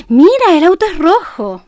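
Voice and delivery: put-on animated slow talk to a toddler; lively child-directed tone. Shown as a demonstration. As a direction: draw the child's attention with delight. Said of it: "entonación es adecuada para el niño".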